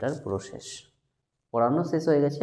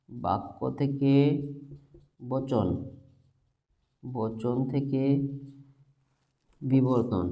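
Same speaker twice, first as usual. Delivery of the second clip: very slow, softer, long pauses between terms; slow dictation; 5 dB quieter, much slower than usual. Shown as a demonstration. Teacher writing out the chain with arrows, slow dictation. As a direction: dictate step by step, leaving time to write.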